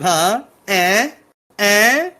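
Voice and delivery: mock hinting, each prompt pushed further up; playful nudging. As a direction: prod a friend for the answer, hammed-up. Acted hinting, nudging a friend.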